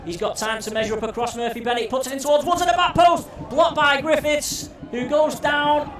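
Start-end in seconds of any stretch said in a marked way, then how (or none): 1.9-5.9 high loud scramble call; excited shout. Real, not acted. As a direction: shout the double block. Goalmouth scramble blocked.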